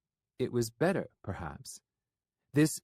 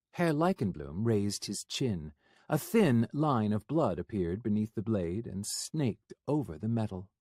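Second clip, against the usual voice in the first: usual speaking voice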